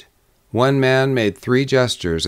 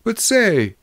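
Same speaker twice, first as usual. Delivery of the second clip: high, agitated interjection; crying out; 11 semitones higher than usual. A narrator's reading. Drummer looking from one to another, crying out. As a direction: cut in with a high cry. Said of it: "he cried".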